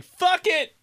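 high, exasperated outburst; pissed off. Retold or imitated. Fed up with a bad phone connection, pissed off. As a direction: burst out as if giving up in anger. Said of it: "I've never been more pissed off".

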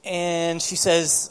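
ordinary talk

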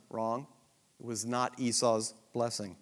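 usual speaking voice

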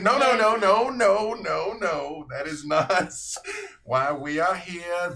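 loud, high, laughing no-no-no; laughing protest. Acted. Siblings frantically deny any threat to the guests, loud and laughing. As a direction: protest loudly while laughing.

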